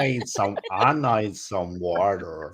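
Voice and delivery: slow Southern drawl, words stretched out; slow, lazy Southern drawl; Forrest Gump drawl. Retold or imitated. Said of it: "you all sound like Forrest Gump".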